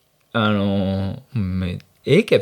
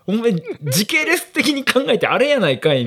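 mock accusing retort; 11 semitones higher than usual. Retorting to friend on phone.

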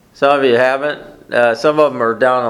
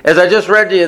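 ordinary delivery

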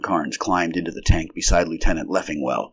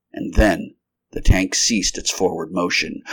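usual speaking voice

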